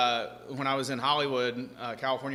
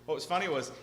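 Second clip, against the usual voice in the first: ordinary talk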